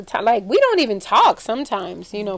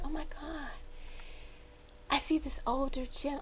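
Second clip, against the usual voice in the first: soft, near-whispered retelling; hushed awe; 4 semitones higher, 15 dB quieter than usual. Retold or imitated. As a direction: voice a medium's vision under your breath. Retelling medium's hushed vision in kitchen.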